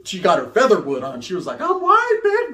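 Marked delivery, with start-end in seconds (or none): 1.6-2.5 high, loud mock girl's snap; a hammed-up girly retort; sassy girl voice. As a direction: snap it like the offended homegirl.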